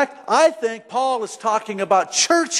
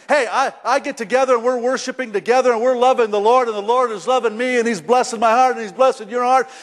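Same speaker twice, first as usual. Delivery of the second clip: ordinary talk, nothing marked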